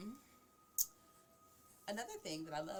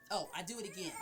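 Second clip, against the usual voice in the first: ordinary delivery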